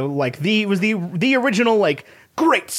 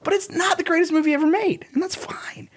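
whisper; 10 semitones higher than usual. Real, not acted. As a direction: confide it in a hush. Aside dropped to a whisper.

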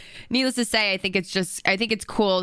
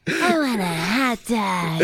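Legally Blonde impression; much slower than usual. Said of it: "I'm trying to do that impression".

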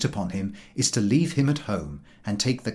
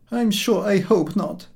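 dejected; 9 semitones higher than usual. A narrator's reading. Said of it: "regarding dejectedly the milky road".

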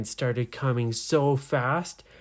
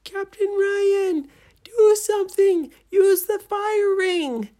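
yelling; 7 dB louder than usual. A narrator's reading. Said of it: "And Nora yelled".

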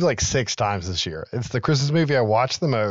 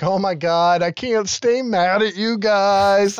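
gushing forgiveness; 8 semitones higher, 3 dB louder, slower than usual. Retold or imitated. Santa forgives reindeer, hugging them.

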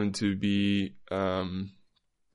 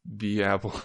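ordinary delivery

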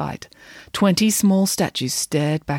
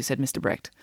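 ordinary delivery, nothing marked

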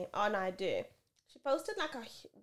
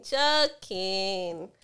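louder insistent joking; 12 dB louder than usual. Real, not acted. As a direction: call it out loudly.

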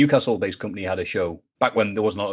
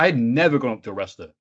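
ordinary talk, nothing marked

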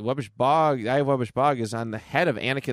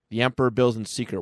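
usual speaking voice

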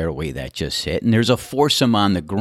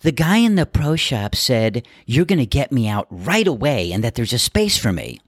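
entitled lady voice; 5 semitones higher than usual. Retold or imitated. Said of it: "she just basically says".

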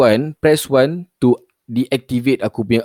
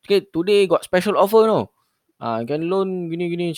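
scam caller voice; 6 semitones higher than usual. Retold or imitated.

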